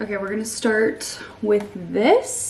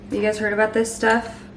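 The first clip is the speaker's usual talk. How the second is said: normal talking voice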